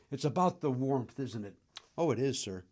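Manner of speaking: normal talking voice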